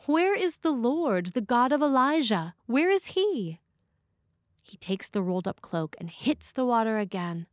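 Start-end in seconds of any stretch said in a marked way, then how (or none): 0.1-3.6 high, louder, pleading call into the sky; desperate cry to God. Acted. Elisha at the river, calling for God, high and loud. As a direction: cry out where God has gone, raised and urgent. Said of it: "He then says".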